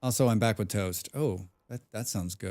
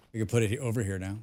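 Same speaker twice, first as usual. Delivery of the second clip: ordinary delivery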